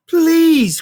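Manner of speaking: high, loud plea; pleading cry. Acted. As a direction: beg him loudly. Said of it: "cried Frog".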